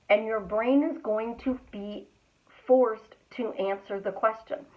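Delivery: ordinary talk, nothing marked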